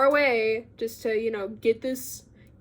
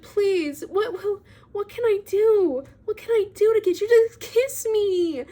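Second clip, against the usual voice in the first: much higher, louder desperate whine; mock whiny begging, pitched way up; whining; 10 semitones higher, 4 dB louder than usual. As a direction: plead like a needy boy begging. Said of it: "really whining about it".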